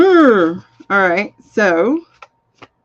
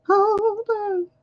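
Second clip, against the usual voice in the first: high singsong hold on; 13 semitones higher than usual. Pretend. Repeats it, higher still.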